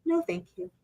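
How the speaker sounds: soft, high, polite no; just say it gently and move on; quiet simple refusal. Retold or imitated. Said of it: "it's just as simple as".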